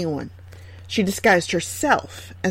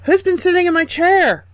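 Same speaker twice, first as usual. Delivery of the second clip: high, louder little dwarf voice; a small dwarf wondering aloud, pitched up; puzzled dwarf; 10 semitones higher, 4 dB louder than usual. A narrator's reading. First dwarf finds his chair used, high puzzled dwarf voice. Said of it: "The first dwarf said".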